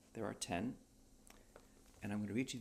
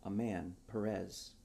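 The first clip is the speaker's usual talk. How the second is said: ordinary delivery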